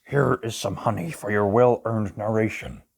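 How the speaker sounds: ordinary delivery, nothing marked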